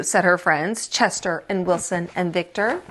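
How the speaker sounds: ordinary delivery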